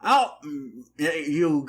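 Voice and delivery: ordinary talk, nothing marked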